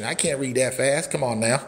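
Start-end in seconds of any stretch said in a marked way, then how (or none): none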